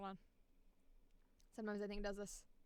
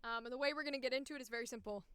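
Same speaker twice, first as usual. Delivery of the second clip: usual speaking voice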